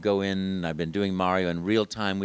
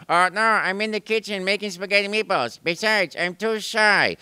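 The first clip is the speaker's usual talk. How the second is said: higher, louder shy brother; shy Luigi; 10 semitones higher, 7 dB louder than usual. Retold or imitated. Luigi refuses from the kitchen, shy but loud. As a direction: beg off shyly. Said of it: "I'd say".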